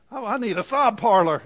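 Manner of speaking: teasing the ladies in the congregation, mock plea; mock lady's plea. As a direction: ham up a tired woman begging for a cry. Ladies voiced wanting a sob parlor.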